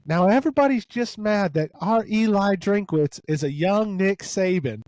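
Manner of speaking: high, lightly voiced fan lady; imitating caller lady. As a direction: mimic a proud local caller. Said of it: "the lady was like".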